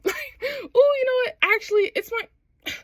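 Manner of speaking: very high, hammed-up stalling; awkward excuse. Character making up a reason to leave, awkward stalling. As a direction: squeak out a mock excuse to escape.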